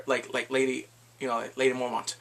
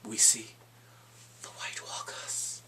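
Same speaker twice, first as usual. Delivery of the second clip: ominous whisper; 5 semitones lower, 8 dB quieter than usual. Acted. Ominous reveal of the enemy.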